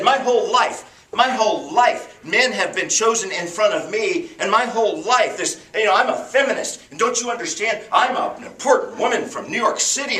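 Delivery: high, loud indignant rant; a woman venting years of being passed over; ticked-off rant. Retold or imitated. Businesswoman at heaven's gate, ranting high and loud. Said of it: "is ticked".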